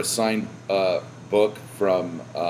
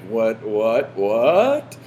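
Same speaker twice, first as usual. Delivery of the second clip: mock brain scream; 3 semitones higher, much slower than usual. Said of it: "my brain was screaming at".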